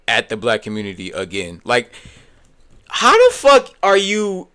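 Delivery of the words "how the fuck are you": loud, high-pitched exasperated outburst; outraged yell. Real, not acted. Streamer challenging the comedian over his joke, outraged. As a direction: demand it in disbelief, voice raised.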